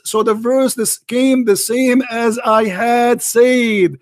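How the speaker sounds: each word laid out slowly; slow emphatic reading. Acted. Debater slowly spells out the quote to his opponent, emphatic. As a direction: drag it out so no one can miss it.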